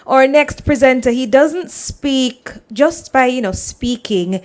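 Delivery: ordinary talk, nothing marked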